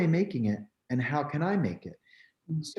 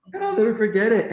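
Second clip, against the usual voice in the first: higher and louder, laughing as she speaks; a real laugh, higher and louder; 10 semitones higher, 6 dB louder, faster than usual. Real, not acted. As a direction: burst out laughing, then say it.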